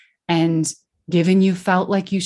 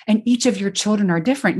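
ordinary delivery

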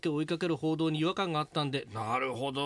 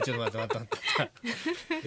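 laughing protest; 3 semitones lower than usual. Real, not acted. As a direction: plead through laughter.

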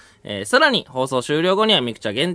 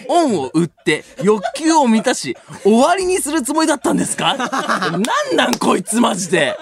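laughing outrage; 5 semitones higher, 5 dB louder than usual. Real, not acted. Reads insulting mail, outraged.

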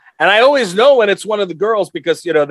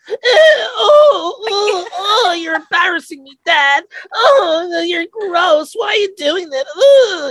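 put-on high-pitched whiny kid, wailing in disgust; hammed-up mortified daughter's whine; embarrassed daughter; 13 semitones higher, slower than usual. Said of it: "and she'll be like".

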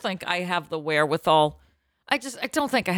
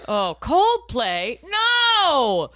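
slow loud dismayed cry; mock horror; 6 semitones higher, 5 dB louder, much slower than usual. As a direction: react in mock despair.

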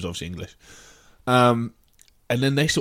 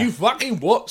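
pitched up, leaning into the fight; aggressive challenge; 9 semitones higher than usual. Retold or imitated. Retelling a pub row to a friend, aggressive challenge. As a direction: snap it like a pub confrontation. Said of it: "All the trigger sort of".

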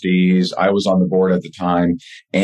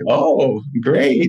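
sarcastic oh great; 6 semitones higher than usual. Retold or imitated. Dreaded trip, fake enthusiasm.

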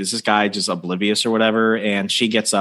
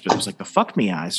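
normal talking voice

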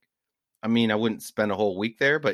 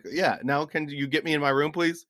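higher-pitched, pointed request, replayed; retold testy demand; 6 semitones higher than usual. Guest answering a rude jerk at the hotel bar, testy. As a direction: re-enact a fed-up guest insisting on service. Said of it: "he was a rude jerk".

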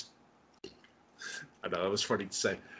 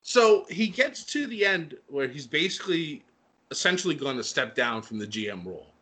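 ordinary delivery

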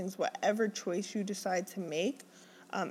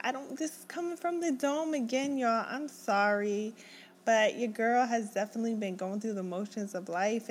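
usual speaking voice

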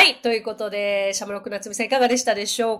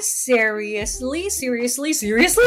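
seriously going from slow to quick with a rising tune; building speed; 2 semitones higher, much slower than usual. Shown as a demonstration. Teacher joins the syllables into a natural seriously. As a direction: speed seriously up until it flows. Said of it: "徐々につなげて".

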